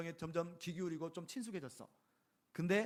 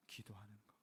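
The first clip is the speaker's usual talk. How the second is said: whispered answer; 8 semitones lower, 16 dB quieter than usual. Real, not acted. Preacher answers the congregation in a hushed whisper. Answering own question softly.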